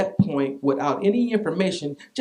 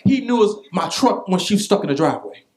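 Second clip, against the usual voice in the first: preaching at full force; 3 semitones higher, 5 dB louder than usual. Acted.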